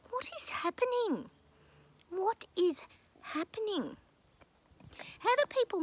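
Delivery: high, disbelieving cry, what is happening; incredulous. Real, not acted. Vlogger to viewers, venting in high-pitched disbelief. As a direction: throw your hands up in disbelief.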